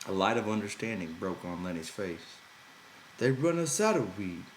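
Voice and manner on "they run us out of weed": higher, louder triumphant outburst; exploded triumphantly. A narrator's reading. Lenny proudly remembering to George, loud triumphant blurt. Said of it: "he exploded triumphantly".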